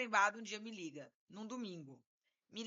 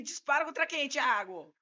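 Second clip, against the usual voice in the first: louder, higher startled demand; alarmed question; 5 semitones higher, 6 dB louder than usual. Retold or imitated. Lawyer hearing client mention gunshots, loud alarmed question. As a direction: react in acted alarm.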